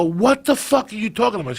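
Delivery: high, mock-shocked outburst; shocked disbelief. Son mock-yelling his shock at his mother. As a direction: act floored by the news. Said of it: "And I go".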